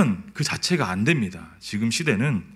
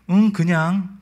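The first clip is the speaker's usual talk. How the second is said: put-on higher, coy childish reply; coy little daughter; 5 semitones higher, slower than usual. Daughter sidling up to dad for a snack, coy child voice. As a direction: play it innocent like a child eyeing a treat. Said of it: "이러면서 제 앞에 와서 서 있어요".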